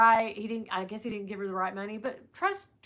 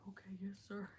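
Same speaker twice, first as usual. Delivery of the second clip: meek reply; 3 semitones lower, 19 dB quieter, faster than usual. Retold or imitated. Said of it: "He was like".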